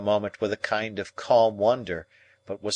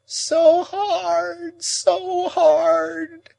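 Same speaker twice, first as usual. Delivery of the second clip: high, loud wailing moan; 8 dB louder, much slower than usual. A narrator's reading. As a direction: wail the words like an old woman in pain. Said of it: "moaned Miss Havisham with her former action".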